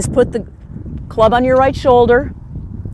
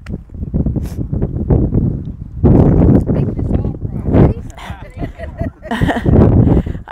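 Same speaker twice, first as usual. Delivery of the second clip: tearful laugh. Real, not acted. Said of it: "I can't help it".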